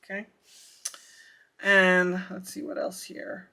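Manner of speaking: ordinary talk, nothing marked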